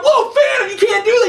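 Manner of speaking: high-pitched imitation of alarmed onlookers; mock alarmed protest. Onlookers objecting to his shark plan, mock alarm. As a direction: object in exaggerated high-voiced shock. Acts people objecting to chemical dumping.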